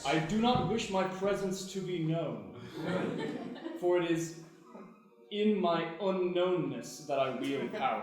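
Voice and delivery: mock-solemn spirit voice; grand spirit. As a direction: declare it like a mighty spirit. Cat poses as great spirit.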